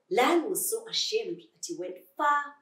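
plain reading voice

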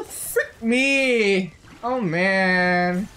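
usual speaking voice

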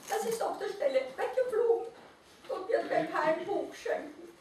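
high, small, self-pitying creature voice; sad little naked mole rat. A narrator's reading. Mole rat alone in the snow, high and forlorn. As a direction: pipe it up like a tiny unhappy animal. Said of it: "sagte der kleine Nacktmull".

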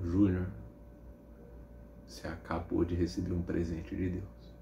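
low, soft, grave announcement; hushed solemn mother. Retold or imitated. Mother telling son of God's gift, hushed and solemn.

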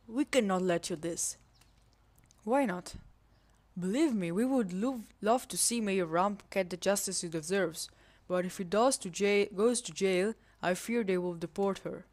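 lightly put-on Spanish accent in English; a rough, self-admitted bad Spanish accent; mock Spanish accent. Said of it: "for my bad accent".

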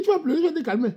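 high sing-song "tu vas pleurer" with stress on "vas"; high teasing "vas"; mocking taunt. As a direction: taunt her lightly. Mocking a crying girl.

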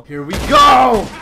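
high, loud, stretched-out "go"; rallying yell; battle shout. Real, not acted. Player charging the guards, battle shout. Launching a gunfight.

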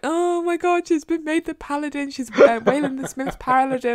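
high-pitched breathless-news gushing; excited news-breaking. Retold or imitated. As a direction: imitate a friend's frantic message. Acts out friend's frantic message.

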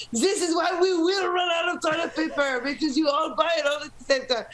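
hammed-up French accent complaint. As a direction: imitate an annoyed French friend's accent. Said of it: "I love that French accent".

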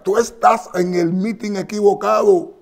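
high, loud mock comeback; imitating the candidate answering the heckler; Kamala Harris retort. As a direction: snap back at the heckler. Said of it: "Kamala le contesta".

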